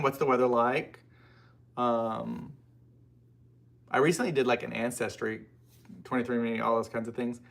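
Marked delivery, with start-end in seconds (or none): none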